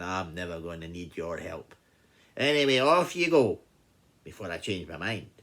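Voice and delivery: lightly voiced lion shooing the mouse off; dismissive lion. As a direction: swell on the send-off, then drop back.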